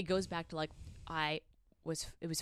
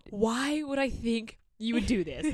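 slow, louder mock disbelief; incredulous; 4 semitones higher, 9 dB louder, slower than usual. Woman answering his accusation about the hand, incredulous. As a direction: stretch it out in baffled protest. Acted incredulous reply in retelling.

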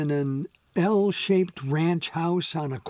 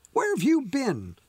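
chiding; 9 semitones higher than usual. A narrator's reading. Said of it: "she chided him chokingly".

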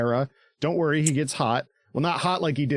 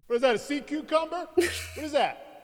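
high panicky Levi; 10 semitones higher than usual. Retold or imitated. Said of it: "he's just kinda in the background".

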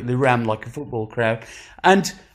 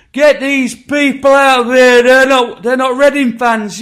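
loud, high-pitched outraged yelling; imitated angry crowd; 10 semitones higher, 9 dB louder than usual. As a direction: shout like fans demanding an ejection. Voices angry crowd around him.

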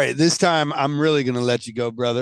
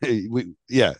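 ordinary delivery

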